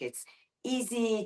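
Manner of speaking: ordinary talk, nothing marked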